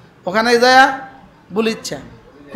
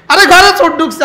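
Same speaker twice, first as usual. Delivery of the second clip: higher, louder cry of ঘরে চোর ঢুকছে; loud alarm; 6 semitones higher, 5 dB louder than usual. Shown as a demonstration. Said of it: "না, আস্তে কও". (উঁচু জোর গলায় ঘরে চোর ঢুকছে বলা)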